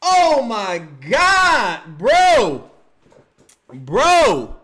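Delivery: loud, high, drawn-out wailing; anguished fan hollering; outraged disbelief. Real, not acted. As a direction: shout it slowly, stretching the words in exasperation. Outraged at his own player's foul.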